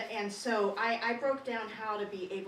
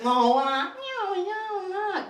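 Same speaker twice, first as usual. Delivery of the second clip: high, loud, slow whine; whiny Mandarin; 9 semitones higher, 10 dB louder, slower than usual. Retold or imitated. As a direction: stretch it out whining. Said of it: "if it sounds like whine".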